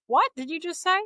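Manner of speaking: high-pitched retold disbelief; imitating a stunned listener, pitch leaping up; incredulous question. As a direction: act out a startled double take. Counselor turns round, reacting in disbelief.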